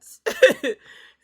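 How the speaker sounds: very loud real cackle; howling laughter. Real, not acted. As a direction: lose it completely, laughing hard. Reacting to half-brother reveal.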